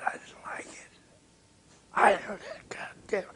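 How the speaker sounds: hushed, voiceless verdict; whisper. Retold or imitated. Producer telling Miles his verdict, in a whisper. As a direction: whisper it so only he hears.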